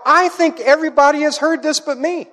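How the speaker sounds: realizing he is the only one left out; astonished thought. Retold or imitated. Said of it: "and I thought".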